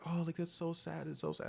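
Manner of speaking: high, soft imitation of sympathy; mock pitying. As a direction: coo it in a put-on sorry voice. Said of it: "trying to convince me to, like, be sad".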